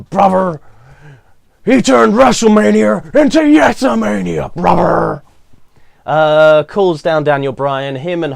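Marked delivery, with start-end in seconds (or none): 0.0-5.2 loud, high wrestler promo shout; Hulk Hogan. Retold or imitated. Reviewer quoting Hogan in the ring, hammed-up shouting impression. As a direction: bellow it like a hyped-up wrestling legend.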